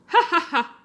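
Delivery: high mocking "ah ah ah"; mocking laugh. A narrator's reading. Chimp laughs at Fortunata's claim. (risata acuta di scherno)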